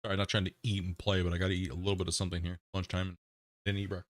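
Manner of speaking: talking while eating, mouth half full. Real, not acted. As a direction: speak around a bite of food. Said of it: "talk with my mouth full".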